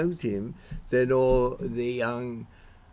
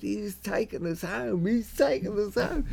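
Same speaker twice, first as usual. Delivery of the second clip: put-on higher, pleading drunk mate voice; 7 semitones higher, 4 dB quieter than usual. Mate explaining to the drunk student, higher pleading voice. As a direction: beg your friend to calm down. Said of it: "He said".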